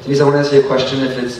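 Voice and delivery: ordinary talk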